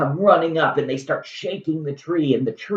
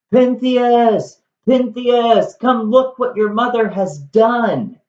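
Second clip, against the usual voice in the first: high, loud call across a distance; a mother calling her son to come see; 8 semitones higher, 3 dB louder, slower than usual. Retold or imitated. Agave calls for her dead son.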